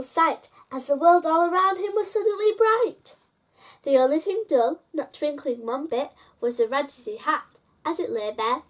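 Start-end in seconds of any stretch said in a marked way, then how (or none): none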